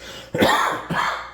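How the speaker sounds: dusty dry cough. Real, not acted. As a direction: cough from sanding dust. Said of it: "Excuse me, still coughing".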